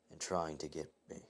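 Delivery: usual speaking voice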